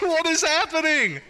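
high-pitched incredulous quote; baffled disbelief. Retold or imitated. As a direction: say it dumbfounded, voice jumping up. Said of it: "And I was just like".

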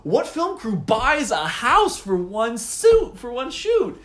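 high, loud, baffled squeal of disbelief; hammed-up outrage at a nonsense gift; incredulous. Baffled reply to game character.

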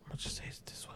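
barely audible whispered aside; retold whisper. Guessing on a hearing test, whispered to himself. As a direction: whisper it as a sneaky private guess.

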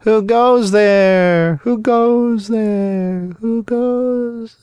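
slow, fading echo from far hills; distant echo. A narrator's reading. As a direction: let it ring out and fade away. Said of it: "the faraway hills echoed back to him".

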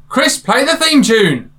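big slow announcer's shout-out; calling out a cue. Real, not acted. Host calls for theme tune.

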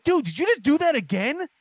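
high-pitched mock disbelief; retold kid's whine at dad; incredulous protest. Retells outrage at repeated punishment.

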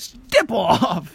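high, squeaky step off; high pitch. Retold or imitated. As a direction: pitch it way up like a toy. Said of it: "It goes high pitch".

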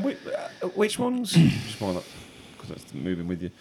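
normal talking voice

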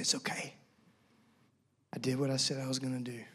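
very soft, low reassurance; hushed, gracious consoling; gentle. Acted. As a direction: comfort tenderly in a near-whisper. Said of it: "he's gracious and he's gentle".

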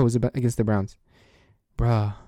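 usual speaking voice

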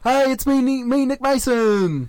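high, loud put-on Aussie greeting; exaggerated Aussie co-host. Co-host introducing himself on the show, exaggerated Aussie voice. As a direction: drag out a high-pitched mock intro.

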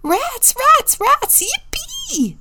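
very high bunny cheer; delighted squeaky little bunnies; excited squealing. A narrator's reading. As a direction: squeal each word, rising into glee. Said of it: "squealed the bunnies".

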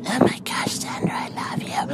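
breathy whispered swoon. Retold or imitated. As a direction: whisper it like a starstruck fan. Fangirl whispering love.

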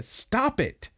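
high-pitched retold outburst without volume; exasperated mock cry. Pets cuts off Kana's excuse, exasperated mock cry.

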